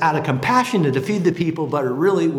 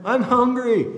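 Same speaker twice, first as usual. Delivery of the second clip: put-on whiny hungry teenager; 7 semitones higher than usual. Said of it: "they're like my teenage son".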